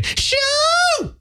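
high, drawn-out cruising holler; catcall shout. Retold or imitated. Buddies cruising past pretty girls, high catcall shout. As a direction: let out a long high whoop at passersby. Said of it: "what we would do is".

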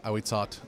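Ahuizot said ah-wee-SOT, final l dropped; native pronunciation. Shown as a demonstration. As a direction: say Ahuizot in three syllables, ending on a crisp t. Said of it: "told me that's how it's pronounced".

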